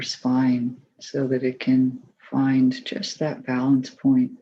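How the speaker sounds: calm, even voice with long pauses; unhurried, settled meditation leader's delivery; guided meditation. Acted. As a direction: guide them gently through the body, leaving space between phrases.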